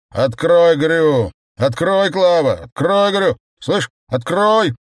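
high, loud demanding yell; drunken shouting. Acted. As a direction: bellow orders at the door. Drunk man kicks door, shouting. (пьяный крик)

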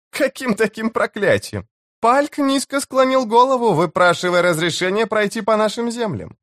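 high-pitched woman's voice, disputing; chief's wife, scoffing. Acted. Chief's wife denying any curse to Karsa, high scoffing voice. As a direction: scoff at his claim in a high voice.

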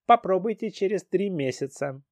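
high, prim customer-service voice; polite clerk voice. Acted. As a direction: recite it like a polite robot clerk. Hologram clerk answers.

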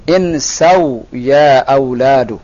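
very slow, drawn-out phrase for repeating; model reading. Shown as a demonstration. Teacher reading together with a student, slow model reading. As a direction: stretch the vowels, let it fall. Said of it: "kita baca sama-sama".

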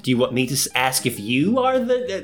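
high, exasperated ranting; hammed-up offended rant; mock indignant. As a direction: protest the joke with exaggerated outrage. Mock indignation at friend's tease.

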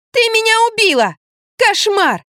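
very high outraged squeal. A narrator's reading. Girl accusing Lampa of killing her, squealing. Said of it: "визжала Лизавета".

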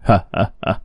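short staged laugh; a brief, separated ha-ha-ha; acted laugh. A narrator's reading. Doctor amused by his patient's plea, acted laugh. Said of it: "bác sĩ Hoàng cười lớn".

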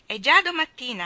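put-on high, loud gleeful child announcement; child bursting in happily; cheerful little boy. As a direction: announce it like a proud toddler. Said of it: "annunziò Demi".

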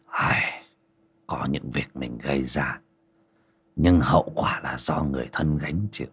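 soft, low, almost whispered sigh; a weary old master murmuring quietly; sighing hushed master. A narrator's reading. Ông thầy thở dài.

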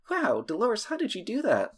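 put-on high, amazed young boy; amazed kid voice. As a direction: gasp it out in awe. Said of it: "he's like".

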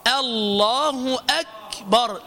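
الله أكبر drawn out slowly and clean; calm teaching take of الله أكبر; slow correct demo. Shown as a demonstration. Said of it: "لا".

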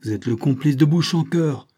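shouted accusation. A narrator's reading. Lieutenant unmasking the false count, shouted accusation. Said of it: "cria le lieutenant".

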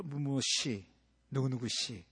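soft, low, gentle naming; polite tender address. Retold or imitated. Dating couple calling each other politely, soft. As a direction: say the name softly and kindly. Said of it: "연애할 때 이름 부르죠".

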